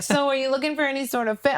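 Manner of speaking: high, sing-song clerk question; sugary store clerk. Retold or imitated. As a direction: ask it like a chirpy salesgirl.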